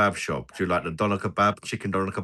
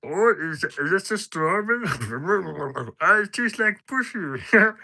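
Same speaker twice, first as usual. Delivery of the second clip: much higher put-on voice with giggles; imitating Patter; 11 semitones higher than usual. Streamer imitating friend Patter eating, high squeaky voice. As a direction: do a silly high-pitched Patter. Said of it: "can't do his laugh".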